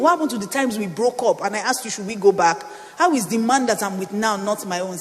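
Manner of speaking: loud, indignant pleading, stumbling over questions; upset. Retold or imitated. Preacher arguing with God about her five-year relationship, upset. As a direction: re-enact an outraged case, piling up the questions. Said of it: "I was so upset".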